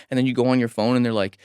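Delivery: usual speaking voice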